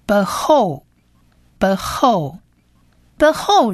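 behold said slowly as "buh-hol", repeated with pauses; behold as Hebrew word. Shown as a demonstration. Teacher models the word meaning "with all", slow pronunciation. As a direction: sound out behold as two syllables, "buh-HOL".

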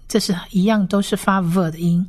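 ordinary talk

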